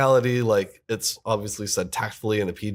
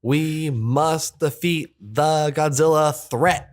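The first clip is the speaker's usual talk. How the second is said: loud, higher, slow mock-dramatic line read; stilted cartoon ambassador; 5 semitones higher, 5 dB louder, much slower than usual. Impersonating the US ambassador at the UN, stilted cartoon voice. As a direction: hammer it out word by word like bad dubbing. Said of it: "pretty good impersonation".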